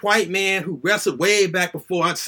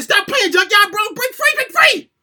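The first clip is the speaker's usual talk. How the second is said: high, loud urging cry; pleading shout; 12 semitones higher, 5 dB louder than usual. Retold or imitated. A boy shouts at the TV for his wrestler to break free, desperate. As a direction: beg your hero to break free.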